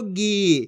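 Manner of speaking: गी with a long held ee; drawn-out vowel. Shown as a demonstration. Teacher models गा with बड़ी ई, गी drawn out long. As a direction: stretch the ई in गी. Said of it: "जिस तरीके से मैं खींच खींच के पढ़ रहा हूँ".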